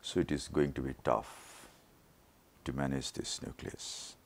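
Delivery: ordinary delivery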